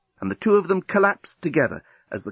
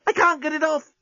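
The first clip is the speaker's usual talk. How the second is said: much higher flustered voice; Lucy frantic; 13 semitones higher than usual. Acted. Lucy struggling with the alien's clothes, high flustered complaint. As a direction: fumble and fret.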